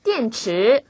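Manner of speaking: 电 falls, then 池 climbs; fourth-second tones. Shown as a demonstration. Teacher models tones for learners, 电 falling, 池 rising. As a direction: drop on 电 and sweep 池 upward. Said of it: "이성, 올라가는 발음도 연습해 볼게요".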